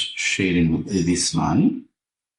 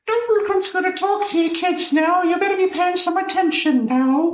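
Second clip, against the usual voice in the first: very high-pitched cartoon professor voice; character impression. Telling the kids about Dumbledore, in a very high put-on teacher voice. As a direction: squeal it out as a hammed-up schoolteacher. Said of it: "McGonagall's just this, like, stock".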